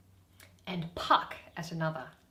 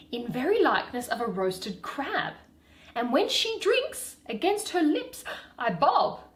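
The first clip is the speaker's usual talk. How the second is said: Puck; 6 semitones higher, 7 dB louder than usual. Acted. Reading Puck's boasting lines.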